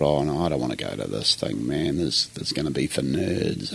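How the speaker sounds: a hushed, grudging inner voice; muttered thought. Retold or imitated. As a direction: mumble it under your breath like a reluctant teen. Retold inner thought, reluctant teen.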